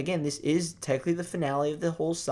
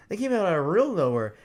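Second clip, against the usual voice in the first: ordinary talk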